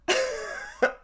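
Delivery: high-pitched giggle; high laugh. Real, not acted. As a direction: giggle at your own joke. Laughing at own troll joke.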